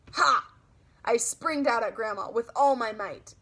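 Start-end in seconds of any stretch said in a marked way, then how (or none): 0.1-0.5 sudden loud high ha. Acted. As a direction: burst out to scare. Springing out at Grandma.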